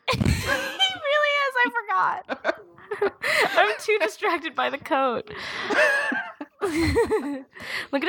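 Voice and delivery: high-pitched, helpless laughter through the words; real laughing fit. Real, not acted. Cracking up with co-host over his outfit, laughing hard. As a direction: talk while cracking up, pitch jumping up.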